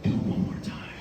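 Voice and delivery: whispered temptation, right by the ear; little devil whisper. Retold or imitated. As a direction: tempt them like a devil on the shoulder. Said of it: "it was a little devil on my shoulder".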